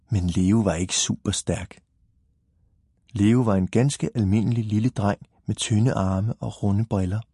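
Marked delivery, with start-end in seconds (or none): none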